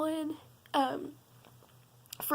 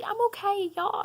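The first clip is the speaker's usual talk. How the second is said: normal talking voice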